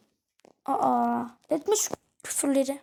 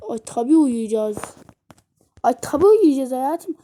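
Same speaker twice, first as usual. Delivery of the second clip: emphatic; 9 dB louder than usual. Pretend. Wife answering husband emphatically.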